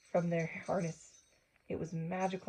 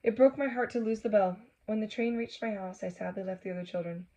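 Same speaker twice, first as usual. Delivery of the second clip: plain reading voice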